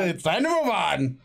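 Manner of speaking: drawn-out, hammed-up replay; mock segue. Host repeating his abrupt segue for laughs, hammed-up. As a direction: stretch the line with a mock swoop up on MOVE.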